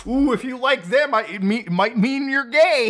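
hammed-up, high-pitched taunt with a stretched ending; mock alarm. YouTuber mimics alarmed online posters, mock alarm.

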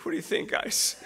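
spent, quiet and drained; a tired, emptied-out question; exhausted. Retold or imitated. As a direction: ask it worn out after reading. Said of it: "I came up just exhausted".

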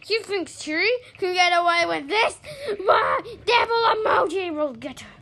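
loud, high-pitched angry threat; mad threat. Pretend. Player threatening Siri with devil emoji, mad yelling. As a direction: threaten revenge at full voice. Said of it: "I'm mad at this".

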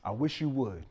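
hint of a parent daring the child; mild mock stern mother; mom's warning. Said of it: "It was".

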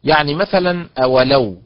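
usual speaking voice

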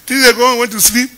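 ordinary delivery

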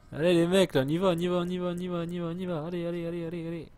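high chant of on y va, fading down; excited cheering. Real, not acted. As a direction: egg the troops on, chanting. Cheering units on.

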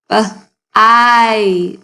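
B, then a long stretched ite; segmented bite. Shown as a demonstration. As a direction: sound out B-ite slowly. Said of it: "hear the I sound in the middle of bite".

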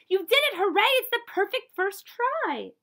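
high, loud delighted cry; joyful cheer. Acted. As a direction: cheer her on with pure joy. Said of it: "hugged her and kissed her".

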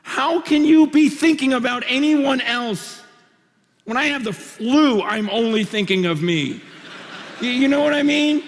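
high, loud incredulous outburst; amazed disbelief. Real, not acted. Preacher marveling at a sick friend's letter, loud disbelief. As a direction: marvel out loud, almost shouting.

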